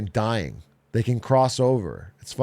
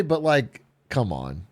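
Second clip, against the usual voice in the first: ordinary talk, nothing marked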